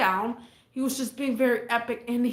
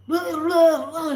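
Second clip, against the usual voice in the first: mock drunk babble; 5 semitones higher, 6 dB louder, slower than usual. Mocking drunk man's rambling.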